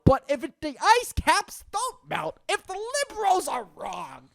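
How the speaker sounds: high, loud strained outburst; mock-excited rant. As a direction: push it up high and loud, over-the-top. Mock-excited rant about ice caps.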